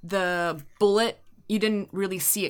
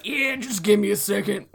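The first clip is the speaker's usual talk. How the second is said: imitated tough guy patching himself. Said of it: "in the corner, like".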